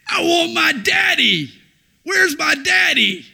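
put-on high, loud child's scream. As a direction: scream for daddy like a terrified toddler. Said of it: "just screaming at the top of his lungs".

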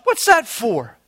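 ordinary talk, nothing marked

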